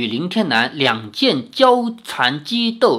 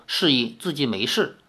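ordinary delivery

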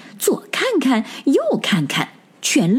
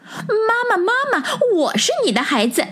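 put-on much higher childlike call; 13 semitones higher than usual. A narrator's reading. Little rabbits calling to their mother, much higher childlike cries. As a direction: cry out for mother. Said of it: "两只小白兔一起喊".

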